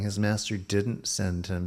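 usual speaking voice

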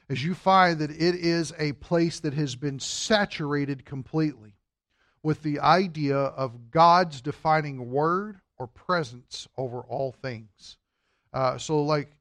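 ordinary talk, nothing marked

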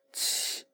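七 with a strong puff of air, voiceless; 七 breathed out hard, no voice; aspirated qi. Shown as a demonstration. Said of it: "发现声带并未震动".

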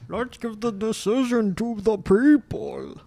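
slow, high-pitched villain proclamation; mimicked supervillain voice. Co-host mocking "what the people wanna hear", mimicked villain voice. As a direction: draw it out like a theatrical movie villain. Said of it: "the thing about that voice".

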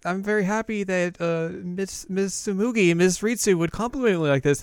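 high-pitched, lightly hammed-up delight; gushing character. Acted anime line in retelling.

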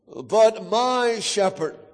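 "my" drawn out slowly before "shepherd"; stress on MY. Shown as a demonstration. Preacher contrasting other shepherds, long emphatic "my". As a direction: stretch "my" to claim him personally. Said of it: "we could major on every word here".

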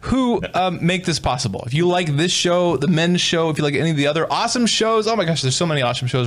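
cheerful podcast ad read; promo read. Acted. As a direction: sell it to the listeners with a grin.

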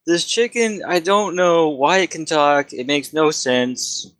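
an overworked wizard sick of chicken questions; fed-up wizard. Acted. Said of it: "No, he's like".

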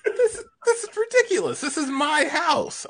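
breaking up mid-line, voice cracking high with laughter; can't hold it together, laughing through the words; high giggling break. Real, not acted. Farmer laughing at talking animals.

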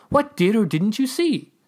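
high, slow, emphatic demand; insistent, drawn-out questioning. A narrator's reading. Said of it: "I demanded".

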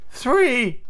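high, lifted single count; counting aloud. A narrator's reading. Slightly counting the fallen pirates, high voice. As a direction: pipe the number up high.

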